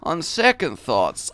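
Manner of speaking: normal talking voice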